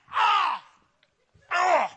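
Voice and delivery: high, loud drawn-out yelps; imitated startled cries. Man struck by praying pastor's hand, hammed-up yelps. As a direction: cry out as if struck.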